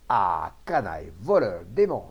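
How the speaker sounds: loud, furious string of insults; angry yell. A narrator's reading. Farmer losing his temper at Rabalan, yelling insults. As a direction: hurl each insult forcefully. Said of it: "hurla-t-il".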